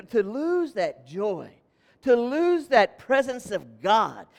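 slow and deliberate; slow weighty phrasing. Acted. Pastor warning of losing God's presence, slow and grave. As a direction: lay each word down slowly. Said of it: "slowed for weight".

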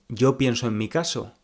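ordinary talk, nothing marked

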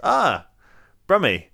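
mock delighted shout of discovery; pouncing recognition. Crew spotting a fellow Brummy, pouncing recognition. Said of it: "they instantly said".

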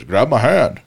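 a hint of an urgent helper; rescuer calling. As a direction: call down to someone in the water. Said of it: "you hear a voice".

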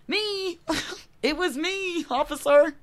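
high-pitched eager confession; hammed-up honest girl owning up; goody two-shoes. Honest girl confesses to officer, hammed-up goody two-shoes. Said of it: "being the stupid goody two-shoes".

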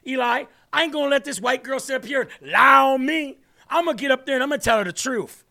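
fast, loud mocking rant with slang; hammed-up imitation of an outraged DA; mock Fannie Willis rant. Mimicking her testimony to the court, fast loud rant. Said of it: "She's like".

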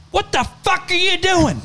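high-pitched hollered imitation of a panicked scream; mock screaming. Imitating Delaney screaming at the driver in the hailstorm, high mock scream. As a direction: screech it way up high, no real volume. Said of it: "Delaney was screaming at me".

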